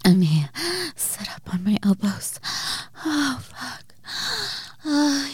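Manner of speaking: breathless, rising moans; moaning. Acted. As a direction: let the pleasure build. Building arousal, moaning.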